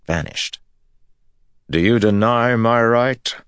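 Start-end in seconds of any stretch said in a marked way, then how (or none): 1.7-3.3 loud, high demanding challenge. Acted. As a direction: demand it with raised voice. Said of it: "Rand demanded".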